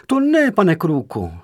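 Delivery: high, loud cry of surprise; alarmed exclamation, pitched high and loud; surprised cry. A narrator's reading. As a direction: cry out in protest, startled. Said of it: "Tu vykřikla překvapením, skutečným či předstíraným".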